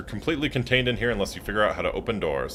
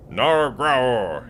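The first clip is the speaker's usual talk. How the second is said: dinosaur growl; 3 semitones higher than usual. Pretend.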